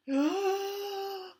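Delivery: exaggerated puppet breath in; big gasp. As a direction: gulp in air far too hard. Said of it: "A little too much".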